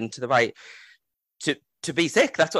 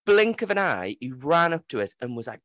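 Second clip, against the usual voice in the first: ordinary talk, nothing marked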